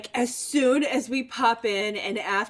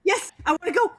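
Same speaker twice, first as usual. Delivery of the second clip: excited; 9 semitones higher, much faster than usual. Real, not acted. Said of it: "I know, I'm excited".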